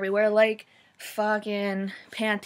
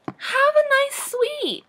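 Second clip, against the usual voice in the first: mock sugary hotel clerk; 10 semitones higher, 4 dB louder than usual. Hotel clerk sarcastically offering a free suite, high sweet. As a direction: ham up a fawning hotel clerk. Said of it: "no hotel like that's ever gonna be like".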